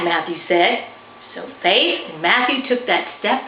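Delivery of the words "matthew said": plain reading voice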